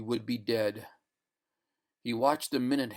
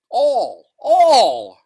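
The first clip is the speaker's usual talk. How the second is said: rising cry; 10 semitones higher, 15 dB louder than usual. Acted. Narration climax.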